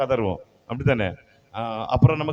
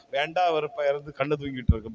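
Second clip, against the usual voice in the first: ordinary delivery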